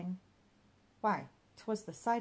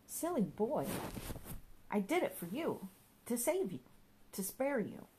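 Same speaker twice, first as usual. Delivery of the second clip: lightly voiced mischievous companion, rising higher; fussing imp; 6 semitones higher than usual. A narrator's reading. Mister Giggles nagging Johnny about dirty things, lightly voiced fussing. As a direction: fuss and scold like a meddling imp.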